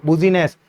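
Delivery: "बुजीनेस" said as bu-zi-ness, each spelt letter sounded; spelling trick said as "बुजीनेस" to remember business; memory-trick pronunciation. Shown as a demonstration. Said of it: "बुजीनेस याद रखो आप तो".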